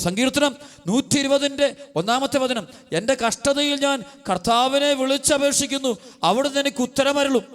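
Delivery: high, impassioned sermon voice; fervent preaching. Acted. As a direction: preach it out with rising fervour. Preacher proclaims psalm verse. (ഉയർന്ന സ്വരത്തിൽ ആവേശത്തോടെ പ്രസംഗം)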